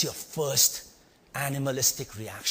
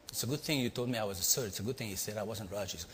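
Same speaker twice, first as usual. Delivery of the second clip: ordinary talk, nothing marked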